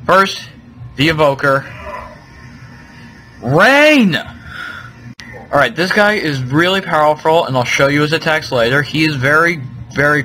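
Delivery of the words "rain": loud, high startled cry; sudden exclamation. Real, not acted. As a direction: blurt it out in surprise.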